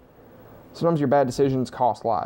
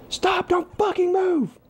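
cop shouting a command; slower than usual. Acted. Acted cop yelling at suspect.

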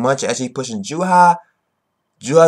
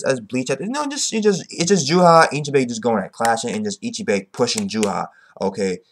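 normal talking voice

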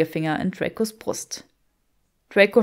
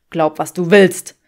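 dismissive; 2 semitones lower, 10 dB louder than usual. Acted. Draco dismisses her.

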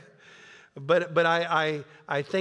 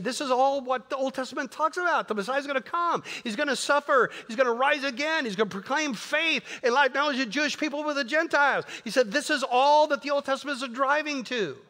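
high-pitched, driving paraphrase; acting out a fervent case; impassioned argument; 9 semitones higher than usual. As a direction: press each point home in a rising preacher's pitch. Acts out Paul's emphatic argument.

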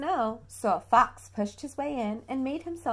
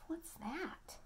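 put-on soft, breathy question to the child; hushed question; 7 semitones higher, 15 dB quieter than usual. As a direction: lean in and ask softly. Reader whispers to child.

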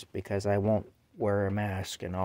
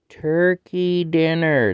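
drawn-out excited call; 6 semitones higher, 10 dB louder, much slower than usual. Real, not acted. Teasing the cat with its food.

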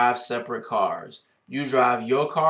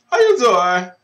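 loud, higher, punchy "How you doing?"; brash catchphrase call; signature greeting; 7 semitones higher, 10 dB louder than usual. Acted. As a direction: belt the trademark hello out to the viewers.

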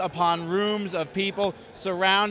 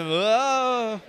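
imitated drawn-out, higher moan; mock moan; 4 semitones higher than usual. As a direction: mimic another man's moan. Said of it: "This man just moaned into a camera".

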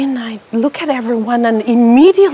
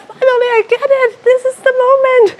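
ecstatic; 10 semitones higher than usual. Retold or imitated. Ecstatic breakthrough.

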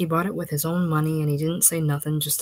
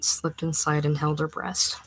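plain reading voice

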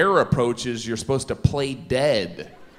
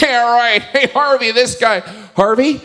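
mocking animal voices; 8 semitones higher, 8 dB louder than usual.

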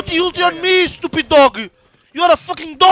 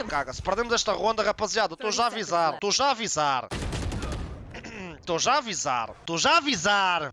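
low, softer repeated warning; 10 semitones lower, 6 dB quieter, much faster than usual. Real, not acted. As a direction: warn the team in a low voice, held back. Warns teammates about losing.

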